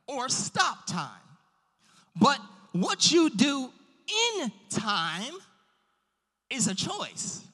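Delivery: forceful pulpit encouragement; emphatic preaching. Real, not acted. Preacher encouraging the congregation about a limitless God, emphatic preaching. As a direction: preach it with drawn-out key words and big pauses.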